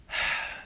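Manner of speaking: soft breathy unvoiced sigh; weary sigh. A narrator's reading. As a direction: let out a tired sigh before replying. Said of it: "Twilight sighed".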